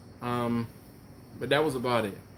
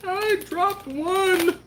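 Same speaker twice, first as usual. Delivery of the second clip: odd high aside; much slower than usual. Real, not acted. Aside mid-sentence.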